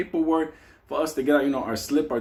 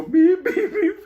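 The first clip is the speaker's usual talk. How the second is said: high, loud "beep" in even pulses; teasing alarm beeps; 13 semitones higher, 6 dB louder, slower than usual. Retold or imitated. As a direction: beep like an alarm to spook a sleeper. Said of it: "I used to go".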